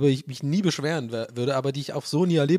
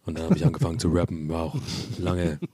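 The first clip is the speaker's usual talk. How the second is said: deep, lazy rapper voice; [name] impression; 9 semitones lower than usual. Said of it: "dein [name] wie".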